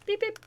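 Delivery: high beeping imitation; phone beeps. Shown as a demonstration. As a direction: mimic the phone beep. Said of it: "the phone beeps twice".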